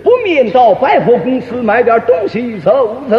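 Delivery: sing-song stage declamation, last word held; affected opera intonation. Shown as a demonstration. Absurdly announcing a shopping trip, affected opera recitation. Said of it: "拿腔作调".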